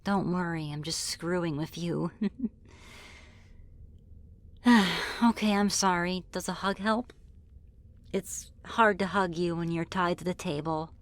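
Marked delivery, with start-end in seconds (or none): 4.6-5.2 short, falling sigh; audible exhale, giving in; relenting sigh. Acted. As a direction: sigh before giving an apology. Relents after teasing captive.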